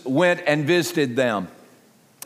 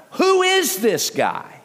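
high, slow, incredulous scoff; scoffing crowd; 9 semitones higher, slower than usual. Retold or imitated. Crowd scoffing at Jesus, high and slow. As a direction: drag it out in scornful disbelief. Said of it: "They scoffed at him".

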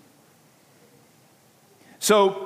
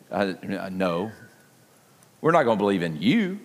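1 dismissive refusal; 10 semitones lower, 4 dB quieter, faster than usual. Retold or imitated. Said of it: "And they say".

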